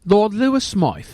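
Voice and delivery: high, loud English lord; put-on English lordly voice; English accent. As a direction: announce yourself as an English lord. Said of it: "I've heard you with the English accent".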